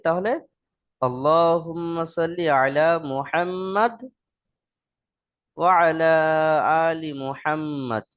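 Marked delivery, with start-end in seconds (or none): 1.1-8.1 slow recitation, stopping after the first "Muhammad"; recitation with pause. Shown as a demonstration. Teacher showing the pausing way, slow recitation stopped at "Muhammad". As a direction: close "Muhammad" on d, pause, then carry on. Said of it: "ওয়াকফ করে পড়ি".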